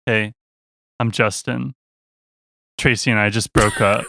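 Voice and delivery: subdued, deflated imitation of a stranger; downcast retold greeting. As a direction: imitate someone introducing himself after a breakup. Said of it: "wrong tone. He goes".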